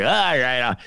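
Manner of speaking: a light mock Trump, vague and rambling; mocking Trump endorsing a stranger; Trump impression. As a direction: lightly imitate Trump fumbling for a name. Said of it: "your Trump's getting better".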